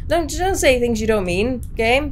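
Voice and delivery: usual speaking voice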